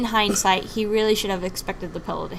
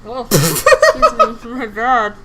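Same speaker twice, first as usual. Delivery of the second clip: laughing apology; 3 semitones higher, 10 dB louder, much slower than usual. Real, not acted. After a burp, laughing.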